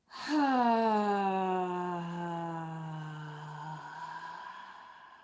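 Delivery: a long, loud exhaling sigh. Shown as a demonstration. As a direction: let a big breath out as a sigh. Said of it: "sighing it out".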